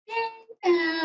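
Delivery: bright squeal of joy; cheering yay. Real, not acted. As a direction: celebrate the good news. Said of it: "we can cheer and clap and be happy".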